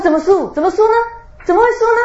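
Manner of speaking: can't believe he lost, voice climbing; mock outraged disbelief building up; incredulous protest.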